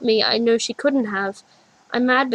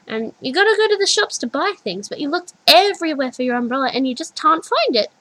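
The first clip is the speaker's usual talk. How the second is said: put-on higher, louder, animated little girl; childlike storytelling; 6 semitones higher, 5 dB louder than usual. A narrator's reading. Little girl describes the rainy storm, animated. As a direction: tell it like a wide-eyed child.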